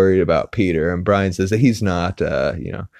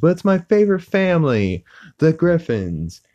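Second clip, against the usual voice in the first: a lightly pitched-up cartoon dad, proudly announcing; voicing Peter; 10 semitones higher than usual. Peter shows Lois his TV world, in a lightly put-on goofy voice. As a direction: sing out the family's name like a proud goofy dad.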